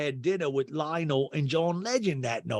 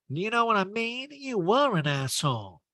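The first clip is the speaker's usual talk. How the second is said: Erica; 9 semitones higher than usual. Retold or imitated. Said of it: "And Erica's like".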